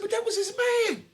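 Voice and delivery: high, loud incredulous outburst; shocked disbelief. Retold or imitated. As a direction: blurt it out in disbelief, pitch shooting up. Acting out his shocked reply.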